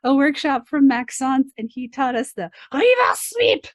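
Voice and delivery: bubbly retold account of the workshop; retelling Phoenix Gray. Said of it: "And he's like".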